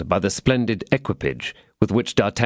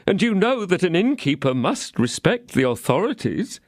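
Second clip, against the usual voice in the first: pleading innkeeper; 10 semitones higher than usual. A narrator's reading. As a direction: beg to be heard. Innkeeper excuses himself.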